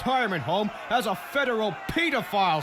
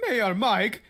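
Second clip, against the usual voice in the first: usual speaking voice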